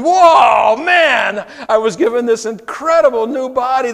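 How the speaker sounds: loud, amazed exclamation; excited shout. Real, not acted. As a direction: burst out in awe at the new body. Awe at new glorified body.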